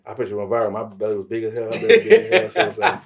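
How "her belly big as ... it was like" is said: high, loud laugh through words; cracking up high. Real, not acted. Laughing at viral photo.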